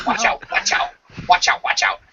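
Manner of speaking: unvoiced parrot chatter. Parrot rolling around, unvoiced squawked warning. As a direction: rasp it like a little bird. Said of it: "all you heard from inside the ball was".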